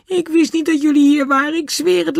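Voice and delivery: put-on high, frightened young boy begging to be believed; frightened boy pleading. Caught boy pleads with the angry men, high and frightened.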